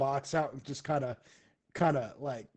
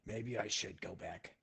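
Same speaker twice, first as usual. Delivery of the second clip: nervous hush; 6 semitones lower, 13 dB quieter than usual. Retold or imitated. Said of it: "Ruckus just kinda starts and goes".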